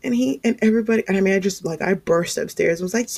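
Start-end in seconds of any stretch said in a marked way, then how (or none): none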